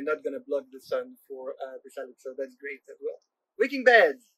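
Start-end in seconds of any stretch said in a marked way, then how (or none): 3.6-4.3 bright cheer; excited. Real, not acted. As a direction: shout it out proudly. Cheerful shout of the bed name.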